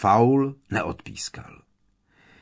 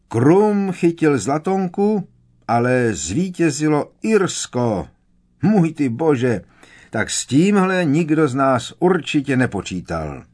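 announcer shout; 5 semitones higher, 4 dB louder than usual. A narrator's reading. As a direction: boom it out like a commentator. Pitloun keeps announcing, amazed. (hlasatelský výkřik)

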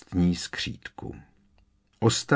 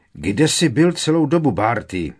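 higher, loud hearty greeting; excited call; 4 semitones higher, 7 dB louder than usual. A narrator's reading. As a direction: call out to an old colleague. Excited official calls colleague.

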